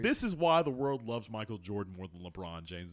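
ordinary talk

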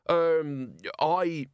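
high, hesitant fumbling; flustered stammer. A narrator's reading. Merchant caught out before the crowd, flustered.

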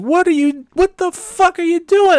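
very high, loud mock outrage; imitated appalled wife. Wife seeing husband lick his arm, appalled mock shriek. Acting out wife's appalled reaction.

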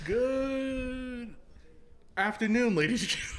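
high, bright host greeting; show host greeting. Pretend. Podcast host opening.

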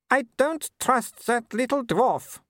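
high, disdainful aristocratic lady; haughty Baroness. A narrator's reading. Mother scorning Vimes to her son, high haughty lady. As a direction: sniff down at everyone like a grand dame.

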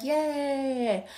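sarcastic yay. Retold or imitated. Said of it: "So I'm like".

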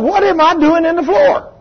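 very high, rising puzzled question; woman waking on the floor, confused. Retold or imitated. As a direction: ask it in a high baffled voice. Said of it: "She said".

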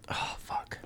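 soft unvoiced aside; whisper. Real, not acted. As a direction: breathe it under your breath.

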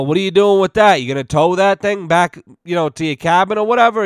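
calling out to stranger. Retold or imitated. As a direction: shout the friendly question across the trail. Friends call to stranded rider.

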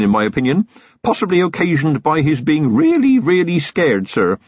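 put-on a proud young rural copper, keen to please; eager young constable. Feeney continues.

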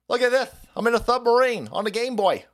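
put-on high excited child voice; eager kid. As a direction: show it off like a kid. Kid self showing Game Boy.

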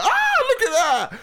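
very high, slow squeal of delight; hammed-up high excited gasp; mock delight. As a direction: squeal at the sight. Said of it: "I was like".

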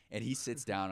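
ordinary talk

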